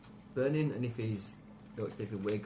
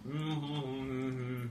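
humming through food; mouth full; 3 semitones higher than usual. Real, not acted. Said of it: "Don't talk with your mouth full".

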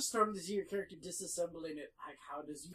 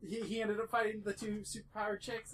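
usual speaking voice

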